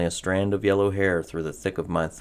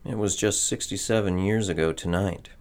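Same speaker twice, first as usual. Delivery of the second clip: plain reading voice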